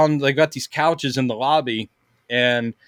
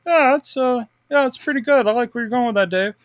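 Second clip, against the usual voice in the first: high, airy, distracted old creator brushing it off; lazy George Lucas voice; 7 semitones higher than usual. Creator on his ranch waving off Filoni's idea, high and distracted. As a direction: play a laid-back boss waving off a pitch. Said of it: "ranch going".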